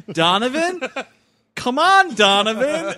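high, loud call into the smoke. Retold or imitated. Kid shouting to Donovan from outside the fire, calling out. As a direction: yell for the friend to come back. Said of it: "he's like".

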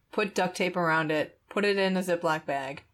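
ordinary talk, nothing marked

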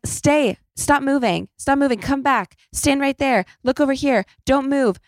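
high, insistent imitation of photographers; imitated photographer shouting. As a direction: rattle off commands like press photographers. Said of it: "there's just shouting".

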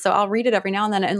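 normal talking voice

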